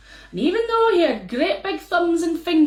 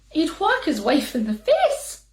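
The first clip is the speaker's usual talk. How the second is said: usual speaking voice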